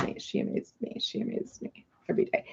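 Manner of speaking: whispered to herself, awed; hushed admiring whisper. Real, not acted. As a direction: breathe it out in a whisper.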